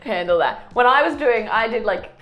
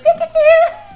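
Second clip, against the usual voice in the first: high, loud laughing Pikachu squeal; squealed Pikachu cry; 9 dB louder than usual. Retold or imitated. Friend joins the story, laughing Pikachu squeal. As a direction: squeal the name like the cartoon creature through laughter.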